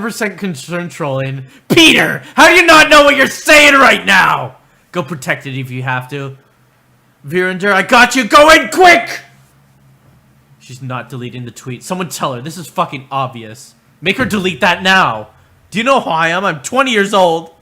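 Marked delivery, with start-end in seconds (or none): 1.7-4.5 high, loud exasperated yell; furious bellow at chat; angry shout. Real, not acted. Yelling at chat member. 7.3-9.2 excited rally cry. Real, not acted. Rallying chat to act. 14.0-17.5 mock entitled rant. Mocking entitled harasser.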